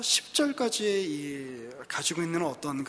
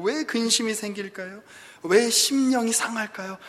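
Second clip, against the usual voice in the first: usual speaking voice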